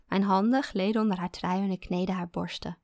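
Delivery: plain reading voice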